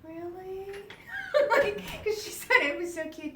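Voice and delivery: high laughing question; laughing disbelief. Real, not acted. Reacting to the nurse's joke, laughing in disbelief. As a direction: ask it high, breaking into laughter.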